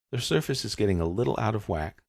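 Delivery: ordinary talk, nothing marked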